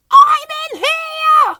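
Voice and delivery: very high, loud, slow squeal; piercing stretched-out little shout; calling out. A narrator's reading. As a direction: call slowly to be heard. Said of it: "called Whimsy".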